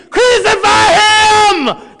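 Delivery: high, loud, drawn-out crowd chant; crowd's shout. Retold or imitated. Retelling the crowd's cry for crucifixion, high slow shout. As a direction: bellow it like a mob demanding blood. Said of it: "They were saying".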